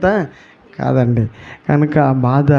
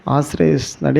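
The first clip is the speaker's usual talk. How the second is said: ordinary delivery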